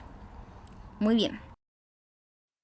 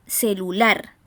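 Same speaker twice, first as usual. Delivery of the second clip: "celular" with a louder, rising LAR; stress on last syllable; 3 semitones lower, 4 dB louder, slower than usual. Shown as a demonstration. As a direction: load the voice onto LAR in "celular". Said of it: "se carga la voz".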